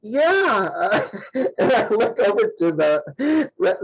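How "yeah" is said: high, drawn-out embarrassed yes; mortified reply. Retold or imitated. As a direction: squirm through a reluctant, laughing agreement. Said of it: "I was so embarrassed".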